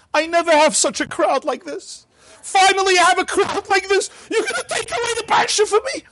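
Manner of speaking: very high, rising wail ending in a shout; pleading old man. Retold or imitated. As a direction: beg to keep your one big crowd. Moshe pleads for his crowd.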